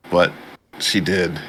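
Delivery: ordinary talk, nothing marked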